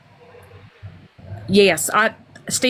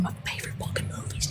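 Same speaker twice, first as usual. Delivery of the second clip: whisper; 17 dB quieter than usual. Real, not acted. Aside about favorite movie.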